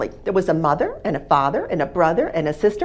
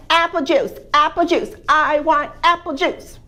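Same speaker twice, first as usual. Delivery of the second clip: put-on high, loud whiny toddler chant; baby voice; 7 semitones higher, 5 dB louder than usual. Little baby to his family at breakfast, whiny high demand.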